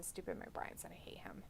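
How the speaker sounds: quick whispered grumble. Real, not acted. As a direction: mutter it under your breath, fast. Whispered aside about wrestler.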